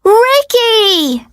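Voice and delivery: high, drawn-out call; high-pitched holler; excited fan shout. A narrator's reading. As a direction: cheer the star's name from the crowd. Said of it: "I shout out".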